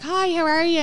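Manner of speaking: high, lilting greeting; cheery greeting reenacted. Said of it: "I'd be like".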